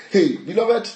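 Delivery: usual speaking voice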